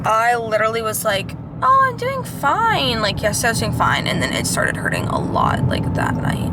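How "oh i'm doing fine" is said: high, slow breezy cheer; naive cheerful. Retold or imitated. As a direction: act blissfully fine. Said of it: "a bit naive".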